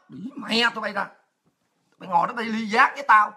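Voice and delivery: ordinary delivery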